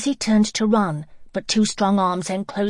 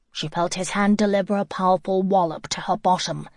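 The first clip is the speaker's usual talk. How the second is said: plain reading voice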